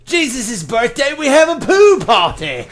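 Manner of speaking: high, loud mock Aussie; horrible Australian accent. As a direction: belt out a badly faked Australian accent. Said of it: "that was a horrible accent".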